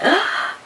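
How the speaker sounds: sharp breathless gasp; shocked gasp. Retold or imitated. Kids hearing mom's harsh words, one shocked gasp. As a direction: suck air in, stunned.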